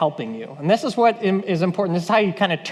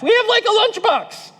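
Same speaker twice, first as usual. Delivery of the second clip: very high, incredulous squeal; hammed-up disbelief; mock exasperation; 12 semitones higher than usual. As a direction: squeak it out as a helpless protest. Acting disciples' exasperated answer.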